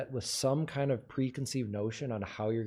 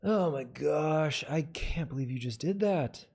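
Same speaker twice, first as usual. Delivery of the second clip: different second take. Shown as a demonstration. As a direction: stretch out GOSH and CAN'T. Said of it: "All right, take two".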